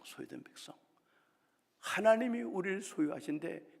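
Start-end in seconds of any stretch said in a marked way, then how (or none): 0.0-0.7 much softer echo; softly echoed. Shown as a demonstration. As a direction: let it fall to a hush.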